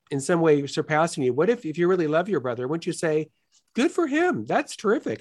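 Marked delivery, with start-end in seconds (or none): 3.8-5.2 happy for him. Pretend. Imagined praise for a brother.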